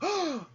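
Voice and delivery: sharp high gasp; shocked gasp. Retold or imitated. The other ducks, gasping in shock at the news.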